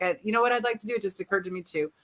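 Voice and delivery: ordinary talk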